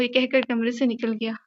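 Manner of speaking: ordinary delivery, nothing marked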